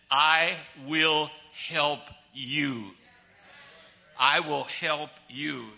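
very slow and deliberate, word by word; slow, emphatic promise. Retold or imitated. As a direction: draw out each word of the promise. Preacher hammers God's promise home slowly.

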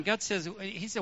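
usual speaking voice

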